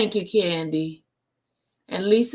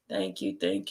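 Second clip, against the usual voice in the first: deep playful thanks; 13 semitones lower, 7 dB quieter than usual. Pretend. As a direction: drop the voice low. Low put-on thanks.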